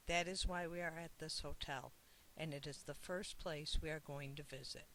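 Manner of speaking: plain reading voice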